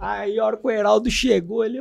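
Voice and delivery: ordinary talk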